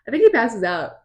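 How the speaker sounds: ordinary talk, nothing marked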